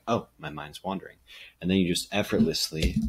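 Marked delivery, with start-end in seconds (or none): 0.1-1.2 soft whispered, unbothered "Oh"; calm inner voice, whispered; gentle noticing. Retold or imitated. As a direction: notice it kindly, without judgment. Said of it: "It's like a".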